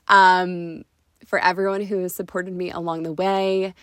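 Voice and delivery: usual speaking voice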